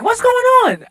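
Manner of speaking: put-on high drunk voice. Shown as a demonstration. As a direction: pitch it way up like a tipsy guy. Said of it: "or if you hear me ever talk like".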